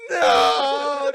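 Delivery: loud, high mock-crying "no"; over-the-top tearful shout; crying wail. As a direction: wail it in hammed-up despair. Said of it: "dude, I'm cry".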